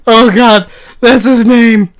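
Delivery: high loud laughing exclaim. Real, not acted. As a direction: crack up while shouting it. Laughing delight at joke.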